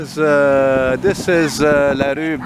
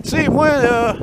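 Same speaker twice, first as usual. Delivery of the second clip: lightly voiced Québécois drawl, pitched up; a hint of friend Antoine's Québécois speech; 11 semitones higher, 3 dB louder, slower than usual. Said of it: "Talk like my friend Antoine".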